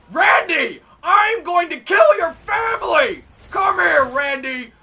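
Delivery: loud, raging threat; mock-murderous shouting; furious yell. Real, not acted. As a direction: scream at the camper. Yelling at camping enemy.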